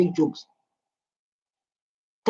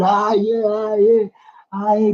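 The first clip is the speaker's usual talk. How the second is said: background crowd. Retold or imitated. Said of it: "people in the background".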